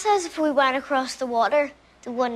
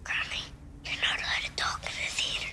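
a hushed, scolding little whisper; 10 dB quieter than usual. Real, not acted. Buddy whispers to granny, telling her off in the theatre. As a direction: shush her without making a sound.

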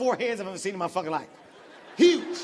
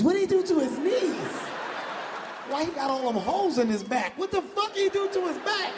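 mock crowd shock; 4 semitones higher than usual. The crowd reacting to tall Kevin, high mock disbelief. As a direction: gasp at his knees and back. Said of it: "I just walk out".

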